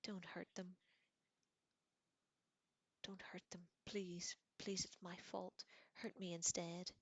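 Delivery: understated pained pleading; a touch of anguish in the begging; moaning. A narrator's reading. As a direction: plead with a slight moan. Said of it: "as he moaned".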